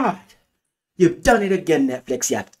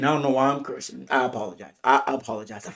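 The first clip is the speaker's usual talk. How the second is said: sheepish apology; 6 semitones lower, 7 dB quieter, faster than usual. Real, not acted. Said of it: "I'm excited".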